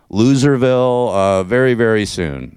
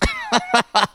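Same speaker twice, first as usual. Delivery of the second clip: high burst of real laughter; 14 semitones higher, 4 dB quieter than usual. Real, not acted. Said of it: "I just gotta laugh".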